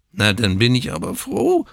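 put-on a grumpy old newborn; whining old man. A narrator's reading. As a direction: whinge it sarcastically. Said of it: "greinte der Alte".

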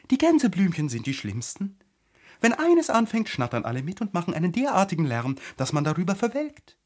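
high, animated flower voice; haughty high-voiced flower; complaining tiger lily. A narrator's reading.

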